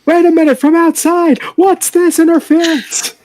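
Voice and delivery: loud, high wrestling announcer. Pretend. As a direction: call it like a ringside announcer. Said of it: "use the announcer voice".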